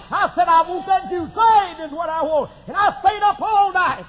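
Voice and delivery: loud, high revival-preacher delivery; fired-up pulpit shout; full-force preaching. Real, not acted. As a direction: preach it hard, voice pushed up and loud.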